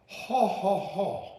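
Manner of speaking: booming Santa in a mock Chinese accent; high, loud ho-ho-ho with a put-on Chinese accent. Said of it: "he's doing an accent".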